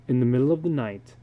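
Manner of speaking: ordinary delivery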